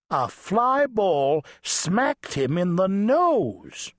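ordinary delivery, nothing marked

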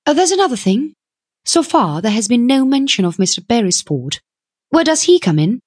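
high-pitched, insistent questioner; plucky young woman. A narrator's reading. Young woman asks where her partner comes in, insistent. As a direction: raise it briskly in a high voice.

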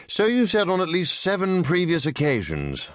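put-on a composed older man, patiently amused; calm, dry gentleman. A narrator's reading. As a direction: let her complaint slide off, unbothered. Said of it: "Doctor Nero replied calmly".

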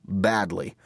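ordinary delivery

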